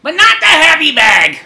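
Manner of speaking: high, slow, whiny nag; wife moaning about the heavy cat litter bag, high whiny nag; nagging spouse. Acted.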